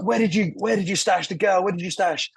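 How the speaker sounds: high, insistent mock interrogation; detective grilling a crying suspect, hammed up; interrogating detective. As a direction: bark the question at the suspect. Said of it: "And he's like".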